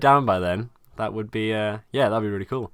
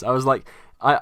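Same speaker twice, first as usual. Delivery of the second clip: ordinary delivery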